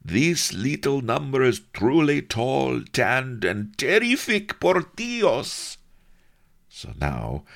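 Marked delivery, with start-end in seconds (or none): 0.0-5.8 high, gloating courtier voice; gleeful chamberlain. Acted. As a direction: relish every word with glee. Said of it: "chortled the chamberlain".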